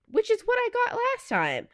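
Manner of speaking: high-pitched amused aside; surprised. Real, not acted. Surprised at same result.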